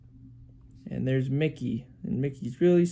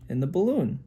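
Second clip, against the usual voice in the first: ordinary delivery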